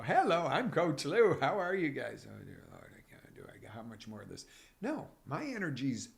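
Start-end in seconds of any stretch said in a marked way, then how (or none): 0.0-2.3 loud, bright put-on greeting; faked energy. Coach faking energy for his viewers, loud fake cheer. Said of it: "I could sit there and fake and go". 2.3-4.4 much softer, lower, half-whispered groan; exhausted underneath. Pretend. Coach dropping the act, weary soft groan. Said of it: "fake and go".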